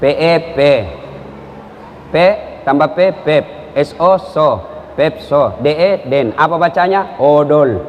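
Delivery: low, halting letter-by-letter spelling; sounding out letters in a low voice; reading lesson. Acted. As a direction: spell it out syllable by syllable, lightly acting a beginner reader. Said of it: "Diajarlah cucunya membaca".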